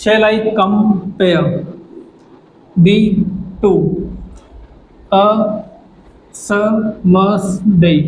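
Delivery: "Shall I compare thee to a summer's day" with stress on I, pare, to, sum, day, very slow; iambic scansion read. Shown as a demonstration. Teacher demonstrating iambic stress to students, very slow and deliberate. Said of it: "यहाँ पे आप देखेंगे".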